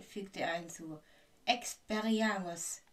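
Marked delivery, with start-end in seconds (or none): 1.4-2.8 loud, drawn-out incantation; called-out spell. A narrator's reading. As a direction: call the spell out loud and slowly. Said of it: "rief Professor Snape".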